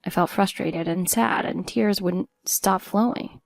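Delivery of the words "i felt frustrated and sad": ordinary delivery, nothing marked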